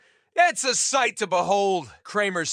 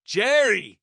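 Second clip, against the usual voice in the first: ordinary delivery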